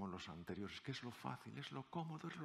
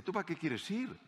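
higher, louder contempt for the newcomer; scornful; 10 semitones higher, 5 dB louder than usual. Retold or imitated. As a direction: look down on the rookie. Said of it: "le miraron con desprecio". (colegas veteranos despreciando al recién llegado, con desdén)

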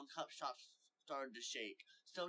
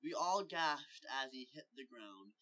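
ordinary delivery

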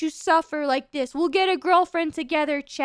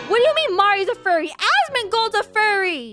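indignant shout; 5 semitones higher, 4 dB louder than usual. Real, not acted. Outraged denial to reacting clip.

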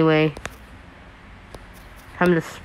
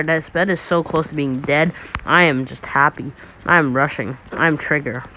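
usual speaking voice

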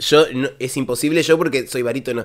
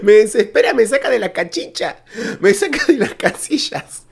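exasperation breaking into laughter, pitch higher; exasperated laughing; 7 semitones higher than usual. Real, not acted. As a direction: laugh through the frustration. Reacting to the singer in exasperation. (desesperado entre risas)

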